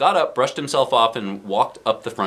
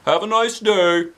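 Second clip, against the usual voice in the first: principal voice; 10 semitones higher than usual. Acted. Principal praises the class in a hammy voice. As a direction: boom it out like a cheery principal.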